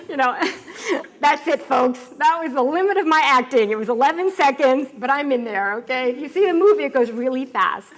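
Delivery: loud, laughing, excited talk; laughing loudly. Real, not acted. Telling the audience about her acting cameo, laughing loud. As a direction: laugh through the punchline loudly.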